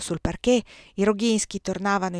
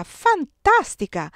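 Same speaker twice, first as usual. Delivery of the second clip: fantastica allungata, lenta e molto più acuta; fantastica strascicata; 10 semitones higher, 5 dB louder, much slower than usual. Acted. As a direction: stira fantastica con enfasi. Ripete la parola, strascicata. (Maurice ripete fantastica, lentamente e più acuto)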